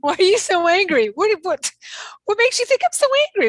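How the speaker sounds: high, loud, fast mock fury; mock angry yell. Replaying the angry denial, a high loud hammed-up yell. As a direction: shout the denial in hammed-up rage.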